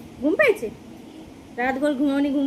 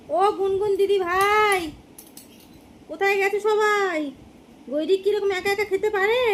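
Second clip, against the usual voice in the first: playful calling out; 5 semitones higher than usual. Pretend. Parent calls uncle and sister to baby's meal, calling out. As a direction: call out to absent family for the child. Calling absent relatives for the baby.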